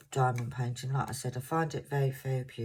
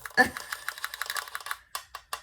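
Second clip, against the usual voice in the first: breathless laugh. Real, not acted. Laughing at own voice.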